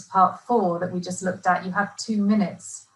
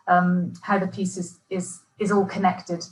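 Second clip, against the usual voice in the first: ordinary talk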